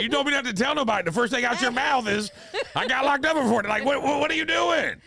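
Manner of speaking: high, loud, fast disbelief; exasperated high shout. Retold or imitated. To his son who blabbed, a high incredulous shout. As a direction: throw up your hands and yell it. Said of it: "And I'm like".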